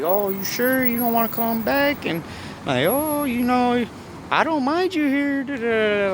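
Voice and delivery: high mock friend voice; worried bestie. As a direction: play the fussing friend in falsetto. Best friend Kimberly voiced.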